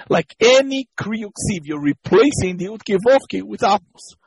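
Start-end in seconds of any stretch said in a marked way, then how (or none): none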